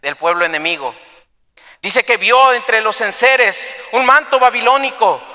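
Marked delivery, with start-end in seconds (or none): 1.8-5.2 high, driving preacher's delivery; impassioned preaching. Acted. Preacher retelling the theft to the congregation, impassioned.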